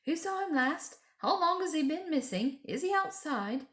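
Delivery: high, worried rush of questions; children fearing for a missing brother; anxious questions. A narrator's reading. Children asking each other, anxious and high. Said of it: "everyone began asking".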